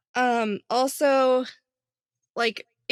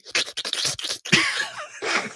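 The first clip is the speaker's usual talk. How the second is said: slurping sound effect. Acted.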